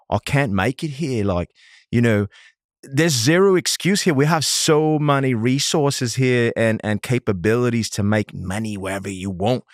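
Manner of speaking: hammed-up, badly faked Australian accent; horrible Australian accent. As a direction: do a really bad Aussie mate impersonation. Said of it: "I'm gonna do a really horrible Australian accent".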